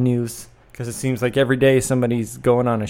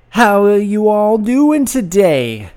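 drawn-out host greeting; 7 semitones higher, 6 dB louder, slower than usual. Acted. Podcast host greets listeners.

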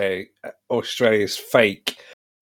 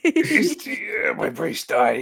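mock agony; 5 semitones higher, slower than usual. Mock agony at friend's ignorance.

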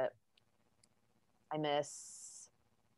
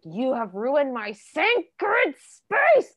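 slow, loud, high mock indignation; mock outrage; 6 semitones higher, 6 dB louder, much slower than usual. To the couple who came in, hammed-up slow outraged declaration. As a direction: drag out each word in hammed-up outrage. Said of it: "I was mad".

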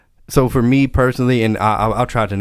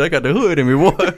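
mock tough guy; 6 semitones higher than usual.

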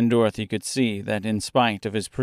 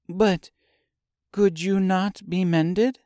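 put-on high, slow girl voice; Dorothy's voice; 9 semitones higher, slower than usual. A narrator's reading. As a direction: ask gently as a girl. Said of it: "asked the girl".

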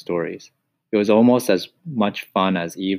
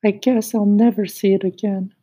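wistful cricket; 13 semitones higher, 3 dB louder than usual. Acted. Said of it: "he added wistfully".

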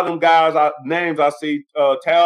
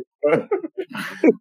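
laugh; 9 semitones higher than usual. Real, not acted. Laughs at his own joke.

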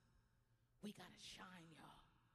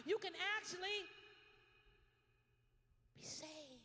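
loud, high, driving repetition; preaching at full force; 6 semitones higher, 8 dB louder than usual. Acted. Pastor exhorting the church, loud and high. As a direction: pound each line harder.

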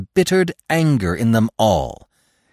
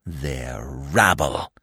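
low, very slow disdain; contemptuous snarl; 7 semitones lower, 4 dB quieter, much slower than usual. A narrator's reading. Fox dismissing the master's followers, slow contemptuous snarl. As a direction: drag it out with boundless contempt. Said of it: "snarled the fox, full of a boundless contempt".

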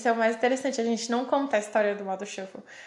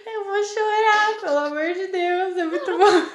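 laughing; 8 semitones higher, 5 dB louder than usual. Real, not acted. Cracking up at the story.

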